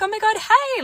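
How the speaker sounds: high, squealy oh my God hey; gushing acquaintance. Retold or imitated. Acquaintance at bowling greeting her, high and gushing. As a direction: greet her like a long-lost friend. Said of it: "she's like".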